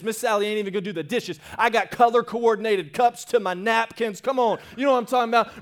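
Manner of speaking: loud, high, driving preacher voice; full-force preaching. Acted. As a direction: preach it hard to the crowd.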